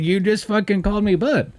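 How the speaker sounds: high-pitched, laughing-edged disbelief; incredulous comeback. Retold or imitated. Retelling his comeback to coworker.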